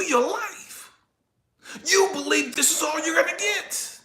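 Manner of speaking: high, forceful, insistent preaching voice; God's rebuke. Retold or imitated. God telling a man he has power, forceful and insistent. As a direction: drive each line home, high and emphatic. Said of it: "And God was telling me".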